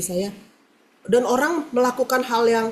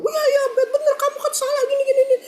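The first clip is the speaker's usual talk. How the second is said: high mocking imitation of her voice; mocking the other woman; much faster than usual. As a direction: mimic her sing-song, pitched way up. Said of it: "Dia bilang". (menirukan suara dia dengan nada tinggi mengejek)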